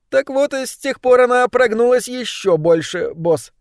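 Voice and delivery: high, squeaky little rat voice; trapped rat. A narrator's reading. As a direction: chatter on in a tiny high voice. Trapped rat warns boss. (высокий крысиный голосок)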